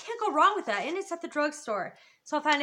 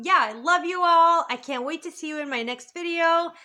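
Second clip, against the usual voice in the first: high, loud, bubbly sign-off; excited goodbye; 3 semitones higher, 9 dB louder than usual. Real, not acted. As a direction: gush the goodbye to your fans.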